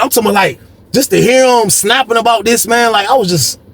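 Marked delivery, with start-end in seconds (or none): none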